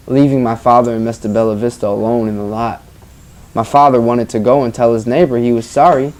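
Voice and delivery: normal talking voice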